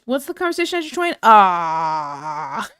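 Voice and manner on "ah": loud laugh. Real, not acted. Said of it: "laughing reaction".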